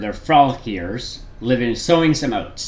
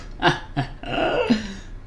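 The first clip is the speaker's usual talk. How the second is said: laughing; 9 semitones higher, 6 dB quieter than usual. Real, not acted. Laughs at own slip.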